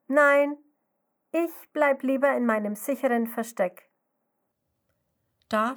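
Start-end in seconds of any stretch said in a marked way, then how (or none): 0.1-3.9 scared refusal. Acted. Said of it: "Sie schüttelt ihren Kopf und ruft".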